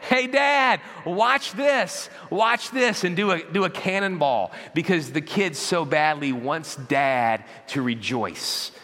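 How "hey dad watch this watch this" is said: put-on high, loud kid yelling from the high dive; insistent, excited child's shout; kid calling out to Dad. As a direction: beg Dad to watch, pitched way up. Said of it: "see a kid on the top of the high dive".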